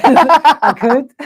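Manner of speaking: high laugh through words. Real, not acted. Mocking her uptalk, laughing.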